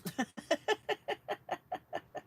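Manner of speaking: bright laugh that climbs up; a real laugh that rises in pitch; high rising laugh. Real, not acted. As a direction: break into a high giggle. Laughing at her own joke.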